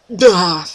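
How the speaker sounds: a besotted gush of nonsense; mock gush. Real, not acted. Fan melting at the sight of Oscar, gushing. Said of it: "to put it eloquently".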